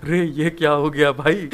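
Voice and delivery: laughing through the words; can't keep a straight face. Real, not acted. Narrator amused by the misunderstanding, laughing.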